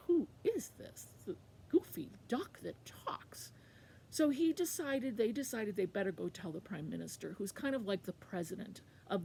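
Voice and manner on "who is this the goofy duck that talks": puzzled guards. Acted. Guards puzzling over the talking duck, high then slow bafflement. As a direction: wonder at it in disbelief. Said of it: "the guards thought".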